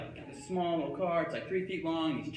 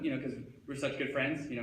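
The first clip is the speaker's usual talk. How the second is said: ordinary delivery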